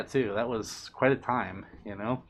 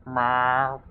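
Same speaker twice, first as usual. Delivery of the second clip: louder, odd cat meow like Archie; imitating a hungry cat; 4 dB louder than usual. Showing chat how hungry cat Archie sounds, mock meow. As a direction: copy the cat's hungry cry. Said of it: "Sometimes Archie makes that sound when he's hungry".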